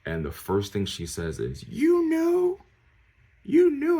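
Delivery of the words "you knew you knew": very high, loud mock outburst; high-pitched, hammed-up disbelief; shocked mock cry. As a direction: imitate a shrill, accusing mom on the phone. Mom's accusing question retold.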